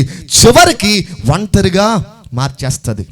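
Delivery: high, loud, driving sermon delivery; full-force preaching. Real, not acted. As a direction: preach it loud with rising intensity. (గట్టిగా, ఆవేశంగా ప్రసంగం)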